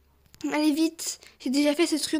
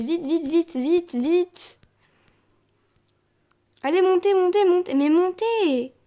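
impatient urging; 3 semitones higher than usual. Real, not acted. Player urging others to climb, impatient urging. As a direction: push the stragglers to hurry up.

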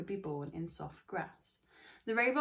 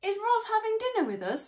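put-on much higher, louder little-girl voice; young girl; 8 dB louder than usual. Jaya asking her dad about Roz, higher girl voice. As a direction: ask eagerly like a young girl. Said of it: "said Jaya".

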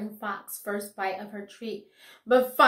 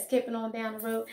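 usual speaking voice

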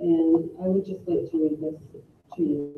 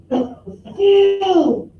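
a high, loud retold shout of a name down the hall; mock yell; 10 semitones higher, 8 dB louder than usual. Surgeon yelling for his colleague at the end of every case, mock yell. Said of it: "I'd hear him screaming".

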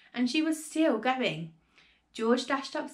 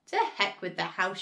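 usual speaking voice

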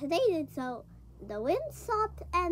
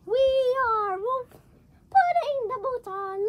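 excited play; 6 semitones higher, 4 dB louder, slower than usual. Pretend. Children playing in the boat.

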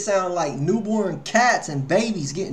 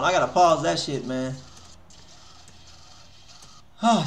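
normal talking voice

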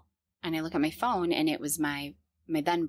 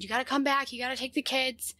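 retold yelling; 7 semitones higher than usual. To her parents walking away in the parking lot, a mild retold frantic yell. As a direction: relive calling her parents back across the lot. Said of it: "I started yelling for my mom".

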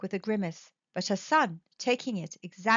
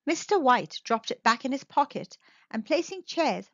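ordinary delivery

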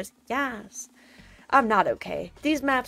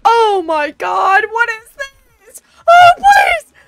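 real panicked shouting, much higher and louder; climbing to a shrieking plea; 12 semitones higher, 17 dB louder, slower than usual. Real, not acted. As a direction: yell in real fright as you fall. Panicked falling in game map.

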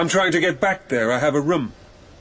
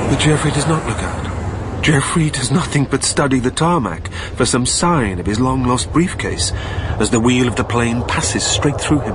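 even, measured narration; story narration; 5 semitones lower than usual. A narrator's reading. Narrator describing the plane passing through Geoffrey, calmly. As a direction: tell it calmly as it passes through him.

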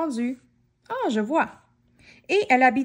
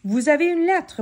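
cheerful postman; 5 semitones higher, 4 dB louder than usual. Acted. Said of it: "annonça gaiement le facteur".

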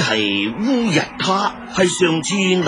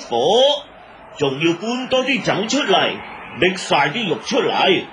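raised, high-pitched announcing call; calling out orders; 5 semitones higher than usual. Acted. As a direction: send the orders across the camp. Elder calls out orders to camp.

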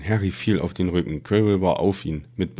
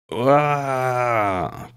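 a sudden high cry of pain; pained scream; 6 semitones higher than usual. Acted. Quirrell burned by Harry's touch, a pained high scream. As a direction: scream as the burn hits.

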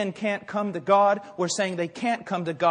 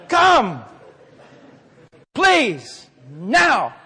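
loud single-word orders with long waits; hammed-up commanding; 3 semitones higher, 6 dB louder, much slower than usual. Samaritan urging a dead man to get up, mock commanding. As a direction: plead and order in mock impatience.

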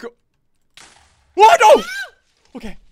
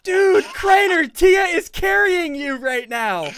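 louder, slower call to a teammate; drawn-out teasing shout across the team; 6 semitones higher, 4 dB louder, slower than usual. Real, not acted. Gamer calling out his teammate, loud and slow.

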